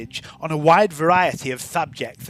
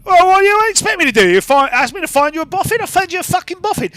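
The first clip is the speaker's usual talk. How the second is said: high, fast and indignant; defensive rant; 12 semitones higher, 5 dB louder, faster than usual. Acted. Gang girl snapping back at the crew. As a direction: fire back fast, exasperated.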